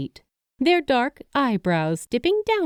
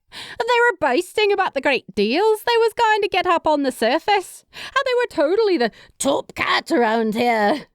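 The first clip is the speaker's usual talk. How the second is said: put-on teasing young woman voice; 9 semitones higher than usual. A narrator's reading. Character voice.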